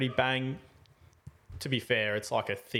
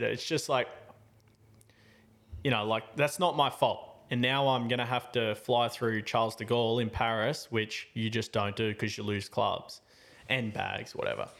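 normal talking voice

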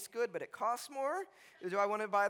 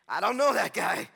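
acted defensive shout; hammed-up shouted denial; loud denial; 7 dB louder than usual. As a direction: blurt it out loud to disown him. Acting Peter's denial to the girl.

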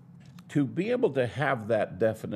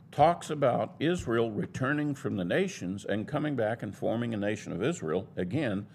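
ordinary talk, nothing marked

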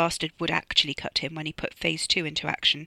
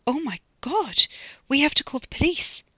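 high, louder cry of alarm; alarmed outcry; 8 semitones higher, 4 dB louder than usual. A narrator's reading. Bunty hears that Liv was killed, alarmed outcry. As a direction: cry out in shock, pitched well up.

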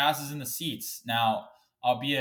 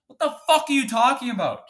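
furious shout; 11 semitones higher, 8 dB louder than usual. Real, not acted. Angry rant about hockey fine.

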